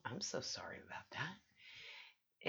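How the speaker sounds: soft breathy whisper; whispered apology. Real, not acted. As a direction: whisper it sheepishly. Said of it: "Oh, that sounded horrible".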